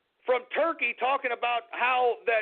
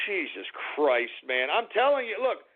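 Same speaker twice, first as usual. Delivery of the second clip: ordinary talk, nothing marked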